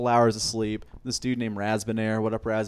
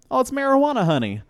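high, louder "marijuana, honey"; sassy offer; 8 dB louder than usual. Retold or imitated. The general offering marijuana paste, campy.

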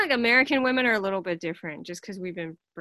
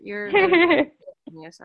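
drawn-out praise; 6 semitones higher, 7 dB louder, slower than usual. Real, not acted.

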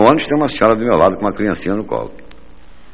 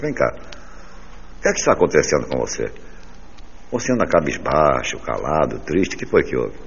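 1 soft caring wife; 5 semitones lower, 6 dB quieter than usual. Retold or imitated. Wife asks gently.

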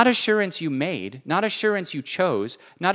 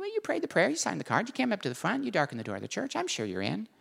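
mock reassurance; 6 semitones higher, 9 dB quieter, faster than usual. Real, not acted. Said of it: "sarcastic checklist".

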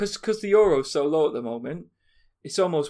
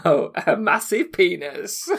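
ordinary talk, nothing marked

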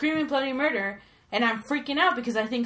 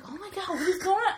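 high, laughing mock alarm; retold panic at a child's scream; 8 semitones higher, faster than usual. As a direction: act startled by a scream, half laughing. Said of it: "And I'm like".

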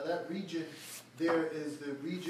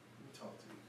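breathy whisper of Let me talk to you; 4 semitones lower, 16 dB quieter, faster than usual. Acted. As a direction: lean in and whisper it. Jesus softly calls man aside.

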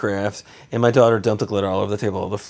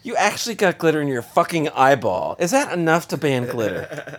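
sarcastic jab; 7 semitones higher than usual. Retold or imitated. Needling wife with I-told-you-so.

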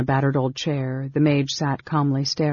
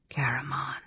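soft, cutting whisper; 9 dB quieter than usual. Acted. Mage interrupting his brother, whispering. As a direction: cut him off in a whisper. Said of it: "said the whispering voice".

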